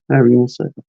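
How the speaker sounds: ordinary talk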